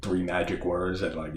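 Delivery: ordinary talk, nothing marked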